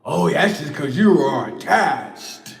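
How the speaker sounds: slow, lightly mocking know-it-all voice; smug spiritual retort. Mocking the critics, a slow smug imitation. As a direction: drag it out like a smug guru. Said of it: "mocking what others will reply".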